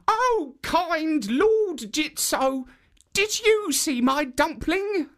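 put-on very high, slow old woman's plea; high, drawn-out old woman calling to the statue. A narrator's reading. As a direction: squeak it out like a frail old granny. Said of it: "asked it".

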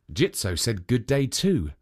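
usual speaking voice

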